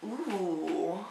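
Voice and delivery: normal talking voice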